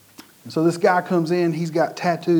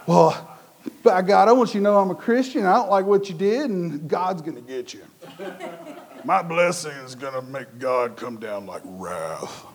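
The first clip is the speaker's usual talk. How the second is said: mock righteous threat; 3 semitones lower than usual. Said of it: "she was like".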